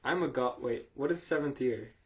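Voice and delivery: normal talking voice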